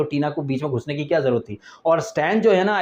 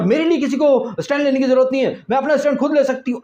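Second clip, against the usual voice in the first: high, hammed-up indignant little girl; mock grown-up little girl; 8 semitones higher, faster than usual. Little girl refusing anyone's support, mock indignant. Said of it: "छोटी बच्ची बड़ी हो जाती है".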